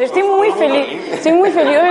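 "Estoy muy feliz" with a laugh in it; laughing happily. Shown as a demonstration. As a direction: let the laugh come through "feliz". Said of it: "A ver, honesto". (riéndose, feliz de verdad)

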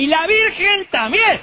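put-on high, slow drunken holler; hammed-up drunken cheer, stretched out; church drunk. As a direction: call out tipsily from the back pews. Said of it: "se paraba otra vez y decía".

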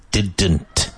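"Didn't" with the T fully pronounced; "Didn't" said with a crisp T; clear T in didn't. Shown as a demonstration. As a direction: hit the T in "Didn't". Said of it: "Pronounce the T in didn't and both Ds".

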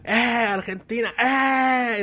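high, loud, fast chant; mock fan shout. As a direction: yell it like a hired fan. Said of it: "está".

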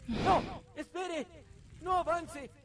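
high, loud, begging it to stop; panicked player yelling at a ghost; terrified pleading shouts. Real, not acted. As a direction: shout at the thing not to come closer. Terrified, refusing to advance.

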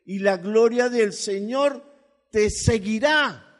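slow, loud proclaiming of the verse; preacher's proclaiming voice; fervent scripture reading. Acted. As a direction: lift the promise high and slow.

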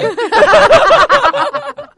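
high, loud burst of laughter. Real, not acted. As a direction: let out a big laugh. Laughs at Burns joke.

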